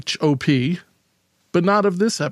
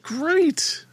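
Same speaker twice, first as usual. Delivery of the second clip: high-pitched squeaky great; 12 semitones higher than usual. Real, not acted. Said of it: "my voice is very high".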